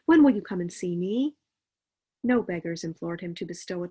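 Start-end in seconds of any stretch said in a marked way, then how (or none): 0.0-1.4 loud, higher friendly call to Scrooge; hearty greeting. Acted. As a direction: hail him cheerfully across the street.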